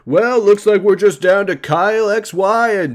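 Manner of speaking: high, hyped-up announcer voice; event announcer. Retold or imitated. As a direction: announce the finalists to the crowd. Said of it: "The announcer, uh, is like".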